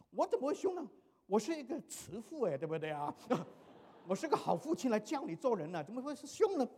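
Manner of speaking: 拔高嗓门，委屈又不服; 提高声调反问自己哪里凶; 激动辩解. Retold or imitated. 父亲被说凶后激动辩解. (被儿子说凶，激动地为自己辩解)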